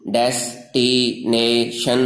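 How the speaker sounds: Destination slowly split into four drawn-out syllables. Shown as a demonstration. Teacher shows four tongue movements in destination, slow syllable by syllable. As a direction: stretch out each syllable of destination. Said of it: "जो ज़ुबान की चार हरकतों से".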